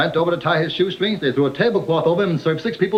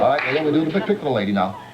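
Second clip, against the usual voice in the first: ordinary talk, nothing marked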